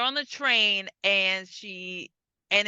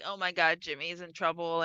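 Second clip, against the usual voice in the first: worried sigh; 6 semitones lower, 5 dB quieter than usual. Retold or imitated. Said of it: "she looks sad".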